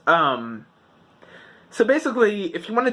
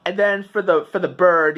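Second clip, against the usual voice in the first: ordinary talk, nothing marked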